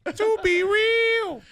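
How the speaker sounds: high gas voice. Real, not acted. Voice altered by gas.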